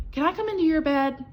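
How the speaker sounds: little mouse begging to share the bed; little mouse pleading. A narrator's reading. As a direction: ask sweetly like a tiny mouse. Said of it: "asked Little Mouse".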